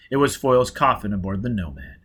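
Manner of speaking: usual speaking voice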